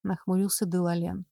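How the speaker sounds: plain reading voice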